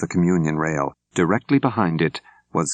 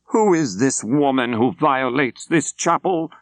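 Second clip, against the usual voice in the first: higher, slow, chapel-filling demand; booming indignation; 7 semitones higher than usual. A narrator's reading. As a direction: let the voice fill the chapel. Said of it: "The voice of Jonathan Merle now filled the small chapel".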